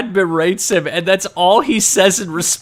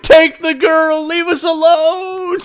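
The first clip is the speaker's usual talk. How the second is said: much higher, slow desperate plea; hammed-up higher, slower pleading wail; mock pleading scream; 11 semitones higher, 3 dB louder than usual. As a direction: beg the shark, drawn out.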